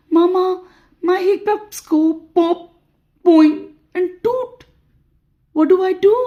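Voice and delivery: put-on high, childlike worried plea; small cartoon monster voice; little monster pleading. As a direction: appeal to mama in a high voice. Little monster pleads with his mama.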